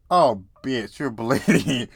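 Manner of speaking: loud retold cry of alarm; hammed-up alarmed outburst; mock panic. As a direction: act out a panicking girl, loudly. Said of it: "the girl started panicking".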